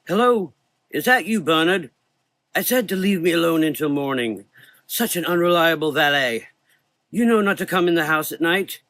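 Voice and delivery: put-on an old doctor calling through the door; weak elderly voice. A narrator's reading. Old doctor calls out to his valet, high and frail. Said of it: "A weak elderly voice called out".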